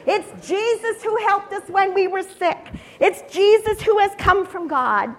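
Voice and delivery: loud, high-pitched joyful announcement; jubilant proclamation. Acted. Crowd telling the city who is riding in, jubilant. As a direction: proclaim it over a cheering crowd.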